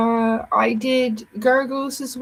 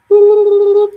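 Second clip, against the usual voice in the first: loud boop; 12 semitones higher, 13 dB louder than usual. Pretend. Playful boop noise.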